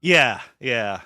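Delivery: normal talking voice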